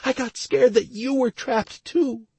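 tearful, high-pitched woman's voice; Stacy. A narrator's reading. Crying wife explaining her panic to her husband, tearful. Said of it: "still crying her eyes out".

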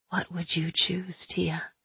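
soft, slow tempting question; quiet. Acted. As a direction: hush it like a temptation. Aina tempting softly.

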